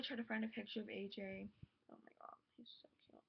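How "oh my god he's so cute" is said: breathless whisper, barely voiced; gushing whisper. Real, not acted. Fan whispering over her idol's photo, hushed and gushing. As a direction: whisper it like a secret crush.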